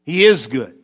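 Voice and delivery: firm contradiction leaning on "is"; stress on IS. Shown as a demonstration. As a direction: hit "is" hard, then drop on "good". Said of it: "when we emphasize it".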